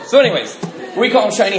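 normal talking voice

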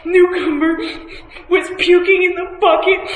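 mock weepy voice, pitched high; choked-up mimicry. Imitating her friend getting emotional in a meeting, mock choked-up. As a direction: imitate a friend tearing up, high and broken by laughter. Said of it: "she'll get choked up in a meeting".

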